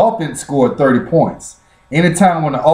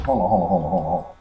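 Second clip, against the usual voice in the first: off mic; 7 semitones lower, 8 dB quieter, much faster than usual. Real, not acted. Moves away to sign rag.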